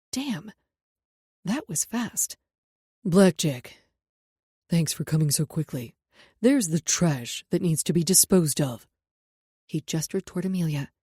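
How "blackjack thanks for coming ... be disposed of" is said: stern bodyguard. A narrator's reading. Jackson gives orders.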